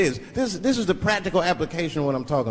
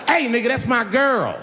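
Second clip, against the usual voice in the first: raised, confrontational warning; mock tough challenge; 7 semitones higher than usual. Woman confronting the comedian over her girlfriend, mock tough challenge. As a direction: step up and claim her.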